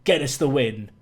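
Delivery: usual speaking voice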